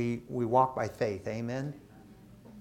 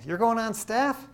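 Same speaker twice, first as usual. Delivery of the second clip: surprised; 9 semitones higher than usual. Retold or imitated. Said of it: "she goes".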